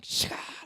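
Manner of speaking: sharp hissing whip noise; imitated whip crack. Showing the audience how he's whipping, imitated crack. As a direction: imitate a whip with a burst of breath. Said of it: "And he's whipping".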